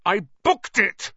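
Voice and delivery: high, loud, slow furious protest; spitting with rage. A narrator's reading. Wood protesting to Flint, spitting rage. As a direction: spit each word out in rage. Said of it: "positively spitting with rage".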